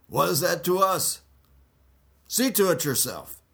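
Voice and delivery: high, loud dismissive scorn; scornful priests. Acted. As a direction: brush him off loudly.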